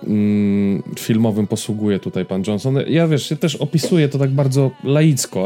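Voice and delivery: ordinary delivery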